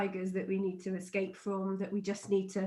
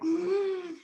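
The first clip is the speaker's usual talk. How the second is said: startled gasp; 9 semitones higher than usual. Retold or imitated. Child covered in wasps.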